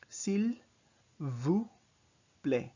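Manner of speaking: "S'il vous plaît" syllable by syllable, stepping down in pitch; French pronunciation. Shown as a demonstration. Teacher breaks down "S'il vous plaît" for learners, word by word. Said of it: "The vous has the long O sound".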